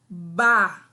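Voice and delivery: "ba." said slowly and loudly for the class to repeat; a teacher stretching out "ba." clearly and loudly; syllable sounded out. Shown as a demonstration. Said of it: "Prestem atenção no som". (sílaba "ba." pronunciada devagar e bem alto)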